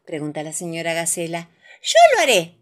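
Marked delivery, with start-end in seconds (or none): 1.8-2.6 agudo y fuerte, con decisión; valiente. A narrator's reading. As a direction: se ofrece con valentía de cuento. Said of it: "dice mamá Pig valientemente". (voz decidida y alta)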